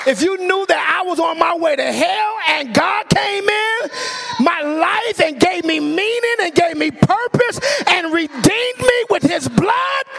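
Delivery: high, loud testimony at full force; real preacher's yell. Real, not acted. Preacher testifying to church about being saved, yelling. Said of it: "I'm still yelling".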